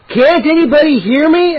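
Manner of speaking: high, loud desperate shriek. A narrator's reading. Trapped boy shrieking to anyone above in terror. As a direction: shriek it out in panic. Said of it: "I shrieked".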